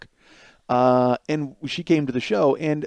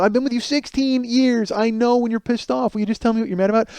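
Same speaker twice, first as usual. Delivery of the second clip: exasperated pleading; 9 semitones higher than usual. Retold or imitated.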